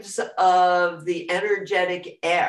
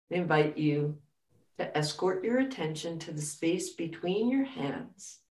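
guided meditation; 3 dB quieter than usual. Acted.

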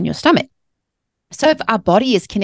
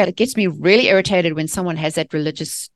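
ordinary talk

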